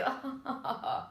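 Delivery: quick breathy laugh; a light laugh after the line; short laugh. Real, not acted. Laughs at own story.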